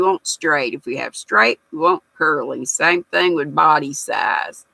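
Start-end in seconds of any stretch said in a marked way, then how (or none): none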